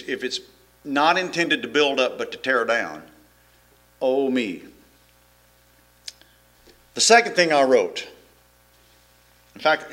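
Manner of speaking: ordinary talk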